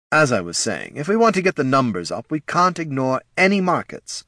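lightly voiced salesman, rising in pitch; resume the pitch briskly; head of marketing. Head of marketing gets back to business, brisk.

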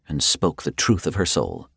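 ordinary delivery, nothing marked

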